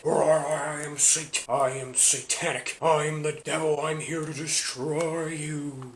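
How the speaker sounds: slow, drawn-out mock devil voice; hammed-up satanic villain, stretched words. The game declares itself to the reviewer as a mock devil.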